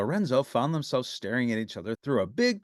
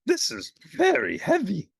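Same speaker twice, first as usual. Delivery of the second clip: strained gasp; 12 semitones higher, 4 dB louder than usual. A narrator's reading. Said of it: "gasped Grover".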